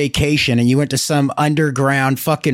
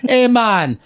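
put-on Jamaican accent; 5 semitones higher than usual. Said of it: "in his Jamaican accent".

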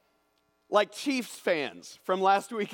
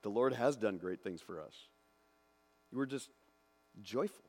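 understated; 7 semitones lower, 8 dB quieter than usual. Shown as a demonstration. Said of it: "the understatement there".

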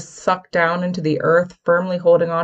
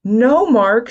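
higher, louder protest; exclaimed; 6 semitones higher, 6 dB louder than usual. A narrator's reading. Stormy stopping Mark, exclaiming. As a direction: shout it to stop him. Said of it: "she exclaimed".

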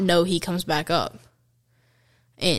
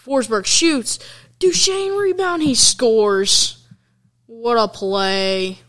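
higher and louder, rapid goal call; excited play-by-play; 5 semitones higher, 5 dB louder, slower than usual. Real, not acted. Calling the two-on-one as Duchene scores, excited. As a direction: build up and shout the score.

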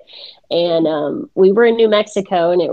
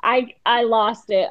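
usual speaking voice

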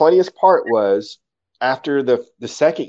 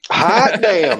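high Southern-style whoop; Southern exclamation; 10 semitones higher, 4 dB louder than usual. Pretend. Guest echoing the host's Southern saying, playful Southern whoop. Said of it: "as they say in the South".

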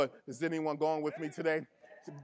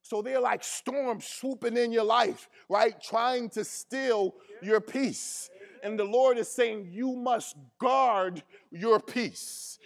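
louder, driving sermon delivery; full-force preaching; 2 semitones higher, 4 dB louder than usual. Acted. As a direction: preach it hard to the congregation.

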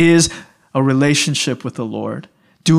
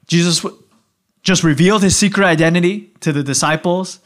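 ordinary delivery

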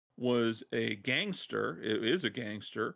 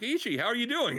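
cheerful gangster greeting; 11 semitones higher, 4 dB louder than usual. Retold or imitated. Gangster boss greeting Ichiban cheerfully.

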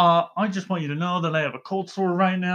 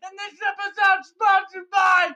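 monster-style yelling intro; 10 semitones higher than usual. Acted. Host greeting his cohost with a monster yell. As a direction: bellow the welcome like a Sesame Street monster. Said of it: "Why are you yelling into the mic?".

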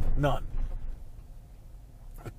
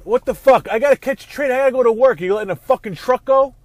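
real road rage, much higher and louder; furious shout; 10 semitones higher, 11 dB louder than usual. Real, not acted. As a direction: yell at the driver ahead in raw fury. Driver stuck behind a truck.

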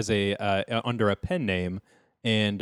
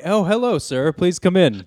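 host greeting; 7 semitones higher, 4 dB louder than usual. Pretend. Greeting an arriving guest.

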